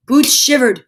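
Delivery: plain reading voice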